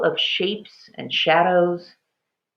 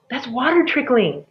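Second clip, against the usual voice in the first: excited; 7 semitones higher than usual. A narrator's reading. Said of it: "she said to herself as she turned".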